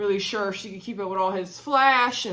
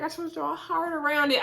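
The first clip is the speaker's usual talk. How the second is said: imitating Jeffrey; 6 semitones higher, faster than usual. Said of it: "It's like".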